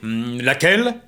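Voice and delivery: king voice. Acted. King asks.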